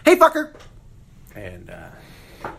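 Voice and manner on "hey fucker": put-on high, loud little-kid shout; shrieked toddler cussing; kid's yell. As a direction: yell it out the window like a cheeky four-year-old. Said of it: "and I said".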